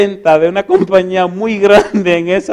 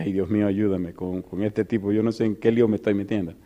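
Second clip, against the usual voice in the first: much lower, softer anxious prayer; inner prayer; 8 semitones lower, 10 dB quieter than usual. Retold or imitated. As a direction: pray under your breath, worried. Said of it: "Y dije en mi mente".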